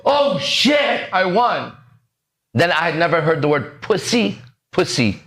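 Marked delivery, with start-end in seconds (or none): 0.0-1.8 slow, high, drawn-out oh shit; lottery-win amazement. Retold or imitated. Teacher acting out a lottery winner's stunned delight. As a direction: stretch it out in stunned joy. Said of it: "when they see the lottery".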